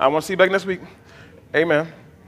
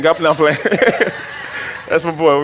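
ordinary talk, nothing marked

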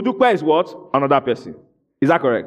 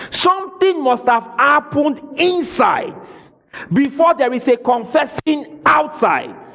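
higher, rising to a preaching shout; 8 semitones higher than usual. Real, not acted. Preacher exhorting his congregation, in a fervent shout. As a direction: drive it home to the congregation. Preacher drives home point.

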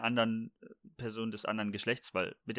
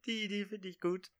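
delighted squeal; 8 semitones higher, 5 dB quieter than usual. Real, not acted. Delighted by the word.

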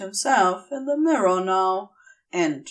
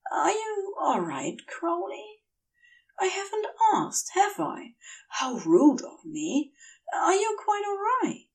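higher, quicker worried fussing; fretting Aziraphale; 11 semitones higher than usual. Acted. Aziraphale worriedly asking Crowley if he's all right, higher and quick. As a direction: fuss anxiously over him.

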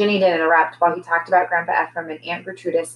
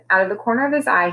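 usual speaking voice

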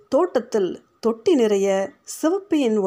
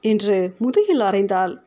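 plain reading voice

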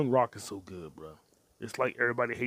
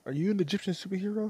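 quick high mock question; mock interviewer; 9 semitones higher, faster than usual. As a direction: tease her like a chirpy reporter.

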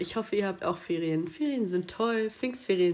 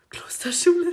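whisper; 9 semitones higher, 4 dB louder than usual. Pretend. Mock-shocked echo of her school.

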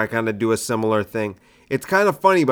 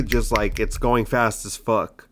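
ordinary talk, nothing marked

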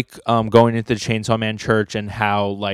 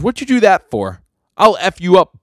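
high, loud angry retort; acted outburst at a tormentor; indignant threat; 9 semitones higher, 7 dB louder than usual. Denji snaps after being poked in the nose, indignant.

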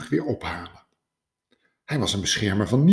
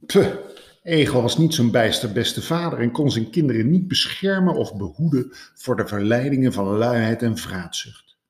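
scornful scoff; 5 dB louder than usual. Acted. Rabbit scoffing at hedgehog's parenting, loud and disdainful. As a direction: scoff at the lazy father. Konijn scornful about Egel.

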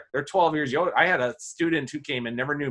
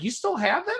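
much higher, amazed "You still have that?"; surprised; 14 semitones higher than usual. Retold or imitated. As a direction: act stunned to see it still there. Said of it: "she's like".

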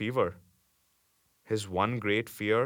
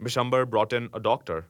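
ordinary delivery

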